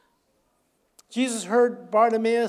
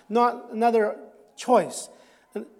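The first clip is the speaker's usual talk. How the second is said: usual speaking voice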